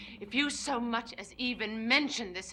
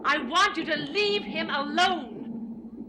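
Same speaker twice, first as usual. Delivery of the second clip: angry warning; 6 semitones higher, 7 dB louder, much slower than usual. Acted. Sister warns brother off Tad.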